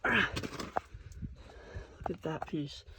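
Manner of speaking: ordinary talk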